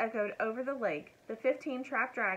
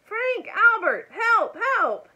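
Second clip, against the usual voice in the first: high, very loud cries; yelling for help; 13 semitones higher, 13 dB louder, slower than usual. A narrator's reading. Elmer calls the men by name for rescue, yelling. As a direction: yell each word as an urgent call. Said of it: "yelled Elmer again".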